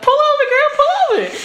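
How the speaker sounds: high, loud mock-urgent call. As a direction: laughingly yell it up high at the driver. Acted urgent call to driver.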